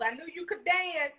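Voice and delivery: ordinary delivery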